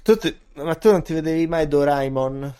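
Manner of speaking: ordinary talk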